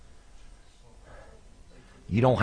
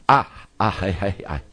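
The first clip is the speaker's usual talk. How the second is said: ordinary talk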